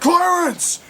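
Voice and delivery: high loud shout of the name; calling out. Retold or imitated. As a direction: yell the name across the room. Hero calling out to villain.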